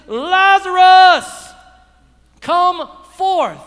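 loud, high, drawn-out shout. Acted. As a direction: call a dead man out of the tomb, long and forceful. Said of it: "he lifts up his voice".

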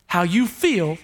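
ordinary delivery

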